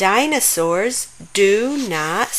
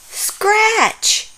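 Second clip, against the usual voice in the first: much higher, straining on the word; squeaky strain; 12 semitones higher than usual. Acted. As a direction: voice cracks under the itch. Group chants the rule.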